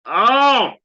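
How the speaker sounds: loud, high "oh" of sudden pain; sharp high cry of pain; real pained yelp. Real, not acted. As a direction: yelp as if a scab just ripped. Accidentally rips a scab while talking.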